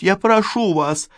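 put-on high, urgent young woman's plea; pleading young woman. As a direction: beg with rising urgency. Yulia pleading.

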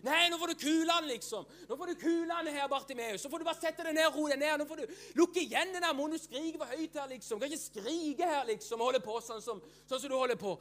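high, fast mock telling-off; scolding crowd. Preacher playing the crowd shushing Bartimeus, mock scolding. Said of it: "Alle andre rundt han".

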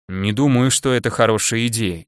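ordinary delivery, nothing marked

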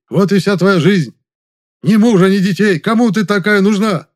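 loud, high furious shouting; drunken yelling brother. A narrator's reading. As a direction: scream insults at her. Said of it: "Софрон кричит Музе".

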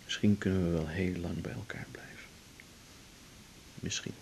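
soft dreamy whisper. Acted. Man hoping to stay together, dreamy whisper.

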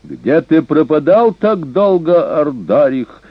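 high, slow, unpleasant sharp call; harsh high cry. A narrator's reading. Insolent barbarian challenging the king, harsh high cry. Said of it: "резким, высоким, неприятным голосом крикнул он ему".

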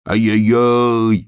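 loud, high, long-held holler; full-throated yell. A narrator's reading. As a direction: bellow it slowly at the top of your voice. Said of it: "во всё горло закричал медвежонок".

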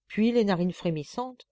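ordinary delivery, nothing marked

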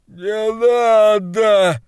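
high, drawn-out, loud begging; slow high terrified cry; terrified plea. Acted. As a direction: beg for mercy in terror.

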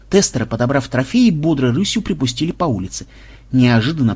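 ordinary delivery

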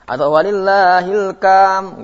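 "walillahil kam" with a k instead of h, high and slow; wrong k sound. Shown as a demonstration. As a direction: mispronounce "kam" on purpose. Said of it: "nggak boleh".

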